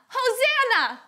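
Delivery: mild cheering shout; a light shout for children to copy; shout demo. Shown as a demonstration. Teacher asking children to shout praise, mild demonstration shout. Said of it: "Can you shout Hosanna".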